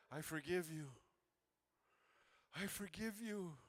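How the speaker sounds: soft, low, gentle "I forgive you"; tender forgiveness. Retold or imitated. Justin hugging his crying abuser, hushed tender forgiveness. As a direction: say it quietly, letting her go.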